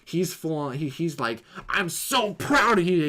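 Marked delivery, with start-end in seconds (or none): 1.7-3.0 higher, slower, mock-proud villain; hammed-up praise to buy time; smug mentor voice. Yon-Rogg to Carol after their fight, a mock-proud slow voice.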